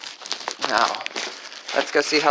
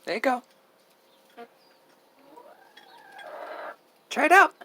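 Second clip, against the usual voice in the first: coaxing pets; faster than usual. Real, not acted. Coaxing a hen to eat.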